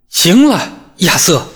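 sharp, high, loud snap; sharp rebuke. A narrator's reading. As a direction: cut him off sharply. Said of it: "茱莉亚厉声说道".

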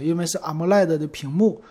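normal talking voice

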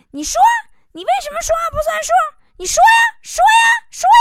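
high, loud, rapid-fire yelling; wife's furious shout. Acted. Wang's wife yells at husband from the room, furious shouting. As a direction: scream the demand at your husband in full fury. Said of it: "就听王嫂搁屋里大喊呐".

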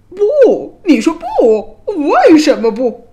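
high, loud indignant bellow; outraged king. A narrator's reading. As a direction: roar back in disbelief at being refused.

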